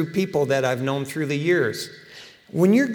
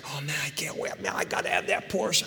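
hushed craving; 9 dB quieter than usual. Retold or imitated. Adult craving a Porsche.

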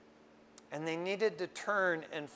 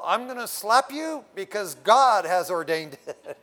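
laughing retort; 2 semitones higher, 7 dB louder than usual. Retold or imitated. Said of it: "I go".

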